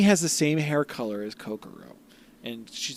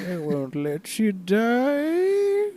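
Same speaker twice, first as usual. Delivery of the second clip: very slow, high, far-off siren; sultry voice; 9 semitones higher, much slower than usual. Retold or imitated. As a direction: stretch it out, breathy and seductive. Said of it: "sultry voice under the waves go".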